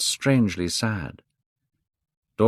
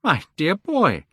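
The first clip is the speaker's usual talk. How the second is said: exclaimed; 11 semitones higher than usual. A narrator's reading. Hallward crying out at Dorian's refusal to sit. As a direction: exclaim it in disbelief. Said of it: "Hallward cried".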